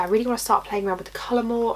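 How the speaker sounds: ordinary talk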